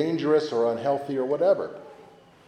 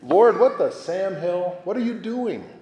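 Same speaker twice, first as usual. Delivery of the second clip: exasperated at God; 6 semitones higher than usual. Pretend. Believer reading Job complains to the Lord, high exasperated plea. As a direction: throw the question up to heaven.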